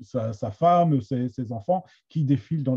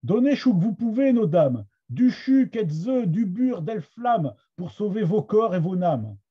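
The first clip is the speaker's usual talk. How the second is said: higher, projected Picard verse reading; Somme Picard accent; 5 semitones higher, 3 dB louder than usual. Shown as a demonstration. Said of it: "avec, euh, mon accent de la Somme". (accent picard de la Somme, voix plus aiguë)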